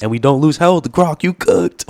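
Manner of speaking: high, loud gamer cheer; excited shouting. Real, not acted. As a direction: yell it out, thrilled. Game finally works, cheering.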